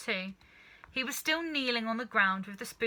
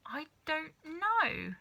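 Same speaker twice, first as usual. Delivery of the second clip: put-on unsure boy; 7 semitones higher, slower than usual. A narrator's reading. George unsure.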